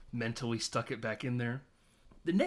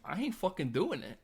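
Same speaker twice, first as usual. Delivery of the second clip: higher-pitched retold student defiance; mock defiant refusal; 6 semitones higher than usual. Chris refusing gym teacher's detention, mock defiant. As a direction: imitate a student digging in.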